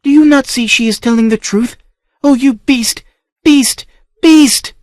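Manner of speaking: furious outburst building. Acted. Niece furious at uncle's cruelty.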